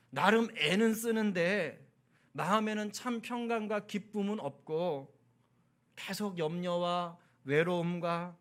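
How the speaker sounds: slow, drawn-out sermon delivery with stretched key words; measured, stretched pulpit exhortation; earnest preaching. Acted. Preaches the closing appeal.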